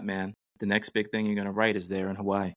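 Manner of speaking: ordinary talk, nothing marked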